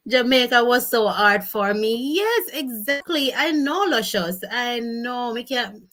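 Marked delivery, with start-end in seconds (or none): none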